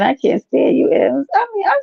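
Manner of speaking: playful high-pitched annoyance; high mock exasperation. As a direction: fake fed up, teasing.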